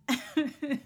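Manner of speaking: a high, giddy laugh in her voice; imitated laugh. Stranger laughs after a compliment.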